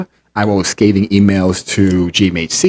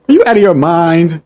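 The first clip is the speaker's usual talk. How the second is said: higher, louder incredulous question; raised-voice indignation; outraged repeat; 8 semitones higher, 4 dB louder than usual. Real, not acted. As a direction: exclaim it in high-pitched disbelief.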